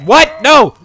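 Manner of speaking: high, loud cry of disbelief; shocked yell. Real, not acted. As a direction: scream it in sudden shock. Sudden game death, shouted disbelief.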